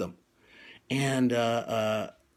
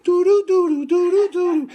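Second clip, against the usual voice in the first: stammering; 7 dB louder than usual. Retold or imitated.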